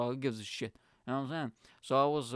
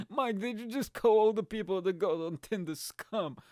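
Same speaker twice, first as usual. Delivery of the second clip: high voice through laughter; amused, high-pitched mock accusation; laughing mock shock; 8 semitones higher, 4 dB louder than usual. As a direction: tease yourself while cracking up. Laughing, plays a shocked listener.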